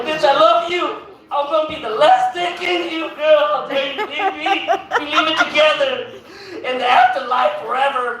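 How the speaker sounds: high, unhinged lover's croon; crazed lover. Retold or imitated. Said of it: "I was like".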